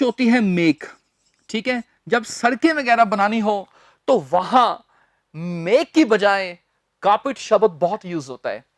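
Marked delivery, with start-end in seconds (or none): none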